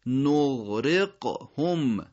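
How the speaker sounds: نغرقهم very slowly, drawn-out gh then crisp q; ghayn, then qaf. Shown as a demonstration. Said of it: "اولیش غین". (نغرقهم کشیده، اول غین و بعد قاف)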